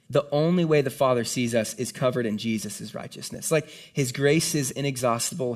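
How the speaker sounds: usual speaking voice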